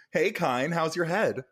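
ordinary talk

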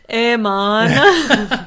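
giggly mock Canadian voice; Canadian accent. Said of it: "Canadian accent".

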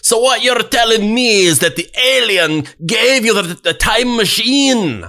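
high, loud Scottish disbelief; incredulous Scottish accent. Retold or imitated. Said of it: "Scottish accent".

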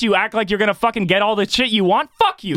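high-pitched, indignant tirade; worked-up, high-pitched outburst; angry role-play rant. Acted. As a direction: fire back as the fed-up lot attendant. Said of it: "Let's do a role play".

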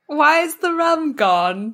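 Jack Sparrow impression. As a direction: slur it like a tipsy pirate. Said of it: "Pirates quote".

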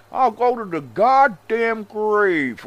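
exaggerated high, loud, drawn-out mock accent; Vince Russo accent. Commentator mocks a wrestling writer's goddams, caricatured accent. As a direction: ham up the fake promo accent, slowly. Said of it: "What the fuck is that accent".

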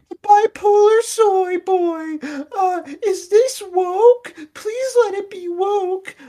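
very high, loud, drawn-out whine; mock a pleading weakling in a shrieking falsetto; whiny soy boy. Mocking who critics want Italian Americans to be, wailing falsetto whine. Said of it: "Do you want Italian Americans to be like".

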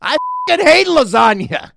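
high, loud disgusted outburst; fed-up buddy. Retold or imitated. Buddy griping in the car, high loud outburst. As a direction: spit it out like he's had enough. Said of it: "He goes".

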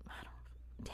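whispered aside; breathy whispered count; whispering. Real, not acted. Unvoiced aside.

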